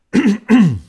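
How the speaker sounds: harsh clearing of a hoarse throat; loud throat clearing. Real, not acted. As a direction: clear the throat hard to fix the voice. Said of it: "forse adesso va meglio". (raschiarsi la gola con forza)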